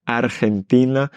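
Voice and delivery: "Argentina" with a trilled r before g; rolled r. Shown as a demonstration. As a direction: roll the r in "Argentina". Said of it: "ce R suivi d'un G".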